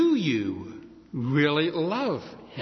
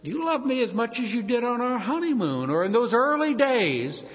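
higher-pitched wifely plea; wife questioning husband; 7 semitones higher than usual. Acted. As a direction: ask like a wife missing the early days. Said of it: "like the wife that says".

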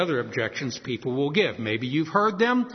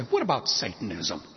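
low, pointed challenge; a darker, confrontational jab; getting dirty; 9 semitones lower, 3 dB quieter than usual. Retold or imitated. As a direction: drop the voice and press the question. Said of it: "I get dirty with them".

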